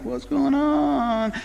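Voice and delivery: high, slow, puzzled what's-going-on; puzzled look. Retold or imitated. Parents staring at the giggling son, high and puzzled. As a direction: act out the baffled family stare. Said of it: "we'd look at him like".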